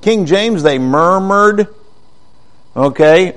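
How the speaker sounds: ordinary delivery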